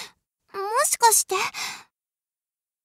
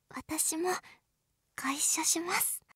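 softer, breathy whisper; 5 semitones lower, 9 dB quieter than usual. Acted. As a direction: whisper sweetly as she lies down. Whispering as she joins him.

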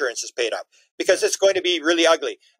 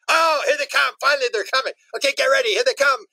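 higher, eager alien voices watching jets arrive; mock eager aliens; 7 semitones higher than usual. Aliens gleefully watching fighter jets approach, high eager voice. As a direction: play the aliens, giddy as the jets come.

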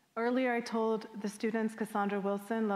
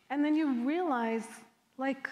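ordinary delivery

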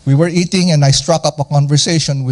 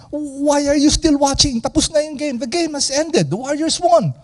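much higher, softer wife's voice, gently nagging; 10 semitones higher, 3 dB quieter than usual. Retold or imitated. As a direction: imitate your wife asking why you're still watching, much higher. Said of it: "And then my wife will say".

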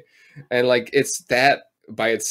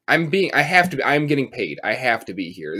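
ordinary talk

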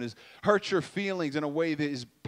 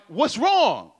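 upset demand; 4 semitones higher, 5 dB louder than usual. Retold or imitated. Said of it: "you get upset".

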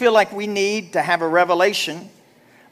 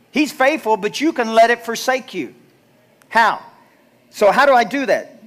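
normal talking voice

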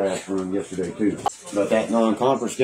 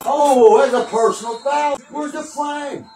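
high-pitched real outcry at the referee; outraged yell; 4 dB louder, slower than usual. Real, not acted. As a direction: yell for a flag, voice shooting way up. Outraged fan yelling at the game.

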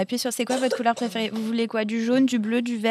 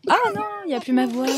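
mouse voice; 10 semitones higher, faster than usual. Real, not acted. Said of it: "avec les voix de souris".

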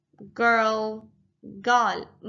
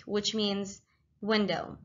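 usual speaking voice

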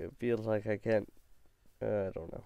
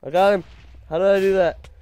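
excited; 8 semitones higher, 12 dB louder, faster than usual. Real, not acted. Surprised kill in game.

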